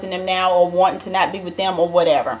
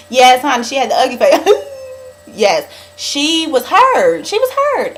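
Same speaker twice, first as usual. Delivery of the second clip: laughing through the words, higher; 4 semitones higher than usual. Real, not acted. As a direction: giggle while telling it. Laughing about Diane's ugly crying face.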